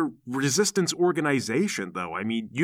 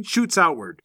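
ordinary talk, nothing marked

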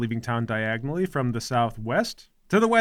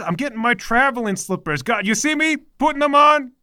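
a hint of a bossy, whiny mother; lightly voiced overbearing mom; nagging mother; 8 semitones higher, 7 dB louder than usual. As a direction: play the domineering mother scolding her son. Mother hurries for slippers.

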